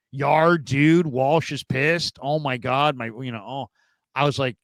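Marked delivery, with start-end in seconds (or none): 0.1-3.0 louder, slow mocking surfer-dude whine; immature dude. Host mocking the rookie QB in the locker room, slow dude whine. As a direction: lampoon the kid panicking about Walsh. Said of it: "he sounded so immature".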